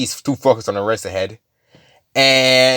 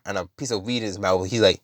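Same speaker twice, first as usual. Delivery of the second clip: ordinary delivery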